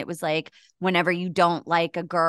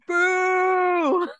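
mock boo; 11 semitones higher than usual. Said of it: "and you're like".

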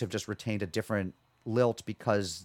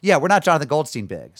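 lightly put-on Canadian accent, louder; a touch of playful Canadian; attempted Canadian accent; 3 semitones higher, 8 dB louder than usual. Shown as a demonstration. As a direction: try speaking Canadian. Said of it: "I'm trying to speak Canadian for you".

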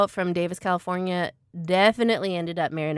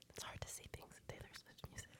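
deadpan whisper; 32 dB quieter, faster than usual. Real, not acted. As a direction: whisper the joke dryly. Dry joke about [name].

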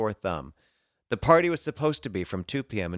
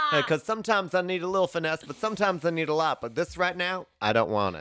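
higher Southern mom fussing over hair products; a hint of a Southern accent, higher; 8 semitones higher, 3 dB louder than usual. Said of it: "Southern accent".

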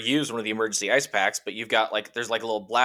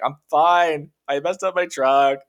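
mock whine; 5 semitones higher, 4 dB louder than usual. His upset reply.

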